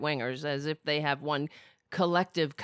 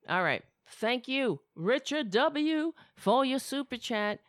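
ordinary talk, nothing marked

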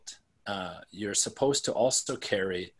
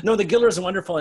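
overly enthusiastic; 10 semitones higher, 9 dB louder, faster than usual. Pretend. Overdone insistence after joke.

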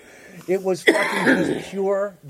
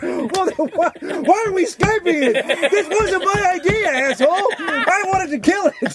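high-pitched comic indignant complaint; mock exasperated quarrel; 11 semitones higher than usual. Vampire hunter bickering over Skype with zombie, mock exasperation. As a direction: argue shrilly in a silly skit.

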